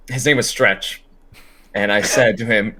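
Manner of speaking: ordinary talk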